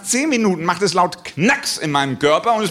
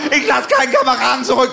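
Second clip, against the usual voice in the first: higher, louder heroic soldier line; war-movie soldier; 7 semitones higher, 3 dB louder than usual. Retold or imitated.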